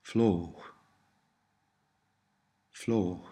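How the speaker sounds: Fló with long o and an H after it; long o, final H. Shown as a demonstration. Tutor models Cornish for learners, Fló ending in an H sound. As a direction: end Fló on a breath of H. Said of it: "the H sound can follow a vowel".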